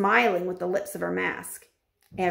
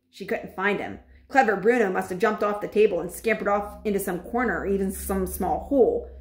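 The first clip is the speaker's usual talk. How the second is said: usual speaking voice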